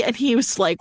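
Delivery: ordinary delivery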